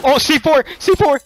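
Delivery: very high, loud alarm yell; panicked shout. Real, not acted. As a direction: scream the warning. Explosives spotted mid raid.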